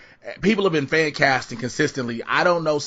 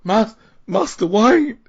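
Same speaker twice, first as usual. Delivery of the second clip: put-on high, slow, louder old butler voice; Michael Caine impression; 7 semitones higher, 5 dB louder, slower than usual. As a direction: drag out a mock-tearful Master Wayne. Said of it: "he has the voice down on point".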